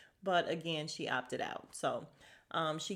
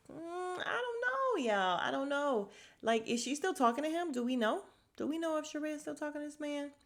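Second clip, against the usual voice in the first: incredulous; 7 semitones higher than usual. Real, not acted. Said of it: "puzzled aside to viewers".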